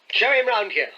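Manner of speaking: put-on commanding older gentleman. A narrator's reading. Host orders the maid.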